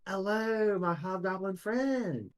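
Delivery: high, slow sing-out greeting; Bill. Acted. Bill greets the hobgoblin, high and drawn-out character voice. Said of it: "he turns to Gormish and he goes".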